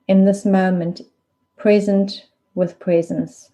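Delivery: unhurried meditation guide, long pauses between phrases; spacious, measured body-awareness guidance; guided meditation. Real, not acted. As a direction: lead them gently into stillness, leaving space after each instruction.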